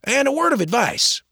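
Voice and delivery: teasing James. Acted. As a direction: tease him like an old friend. James voiced.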